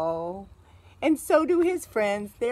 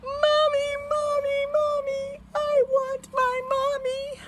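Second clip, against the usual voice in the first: high, wailing cry for Mommy; pretend-cry like a lost little bunny; 12 semitones higher than usual. A narrator's reading. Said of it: "but he started to cry".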